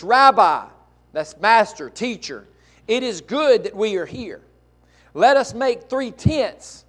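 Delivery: loud, high, climbing preacher's reading; fervent scripture preaching. Acted. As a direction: proclaim the text to the whole church. Preaching scripture at full force.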